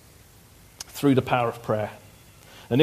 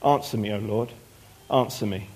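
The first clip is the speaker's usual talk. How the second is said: softer, lower pleading prayer; 5 semitones lower, 6 dB quieter than usual. A narrator's reading. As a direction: beg quietly to be answered. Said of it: "came near and said".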